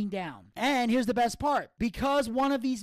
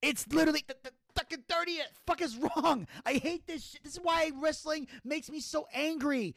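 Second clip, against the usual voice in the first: higher-pitched furious rant at the bookers; angry rant; 7 semitones higher than usual. Real, not acted. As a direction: vent real outrage at the wrestling bookers. Ranting about booking on free TV.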